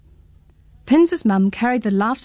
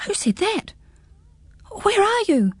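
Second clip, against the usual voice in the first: mild startled gasp; 12 semitones higher than usual. Acted. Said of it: "gasped Pins".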